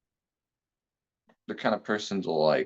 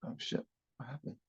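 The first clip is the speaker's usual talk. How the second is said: barely voiced, very soft; hushed dismay; 3 semitones higher, 17 dB quieter than usual. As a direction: retell a worried thought almost in a whisper. Private dismayed reaction, retold.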